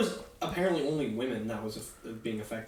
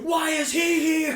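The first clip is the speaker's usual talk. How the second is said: high, slow laughing panic; mock terrified; 14 semitones higher, 7 dB louder, slower than usual. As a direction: whimper it in fake terror. Mock-terrified doll owner.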